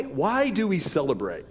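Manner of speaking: ordinary delivery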